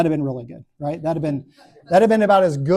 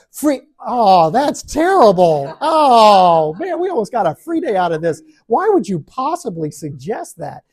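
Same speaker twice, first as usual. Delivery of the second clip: high, loud, whiny complaining; whiny dismay; 5 semitones higher, 7 dB louder, slower than usual.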